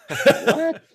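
high, loud shocked yelp; stunned what. Retold or imitated. Player hearing he's called up, shocked high yelp. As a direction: blurt it out in total disbelief. Stunned by big-league call-up news.

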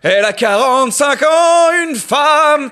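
loud, very high grand proclamation; hammed-up reverence. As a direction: belt it out like a pompous herald. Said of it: "ça la sacralise magnifiquement". (proclamation grandiloquente, très aiguë et forte)

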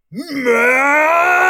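long, high, loud roar; bellow. A narrator's reading. Enemy general roars for captain through the halls, bellowing. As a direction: bellow the name in rage. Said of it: "General Goob bellowed".

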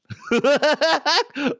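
high cackling clown laugh; Krusty the Clown. Acted. Host attempting requested clown impression, high cackle. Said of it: "he wrote Krusty the Clown".

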